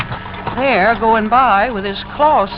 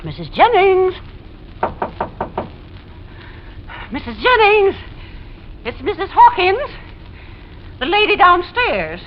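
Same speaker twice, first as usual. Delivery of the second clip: calling out; 10 semitones higher than usual. Acted. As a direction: call up to a neighbour who won't answer. Calling through a closed door.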